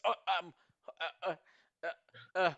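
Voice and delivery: lightly voiced bewildered man, lost for words; confused man. Dazed man coming to on the platform, confused. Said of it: "looks around in confusion".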